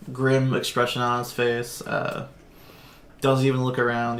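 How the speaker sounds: ordinary talk, nothing marked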